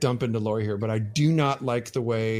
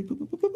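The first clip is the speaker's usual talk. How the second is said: boop sound effect; 3 dB quieter, much faster than usual. Retold or imitated. Mimicking crafting level-up sounds.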